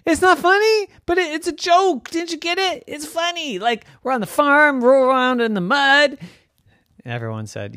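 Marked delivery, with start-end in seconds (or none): 0.1-6.2 high, pleading little pig voice; Dora insisting. Acted. Dora insisting to her friends, high and pleading. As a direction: insist it's a joke, squeaky and earnest.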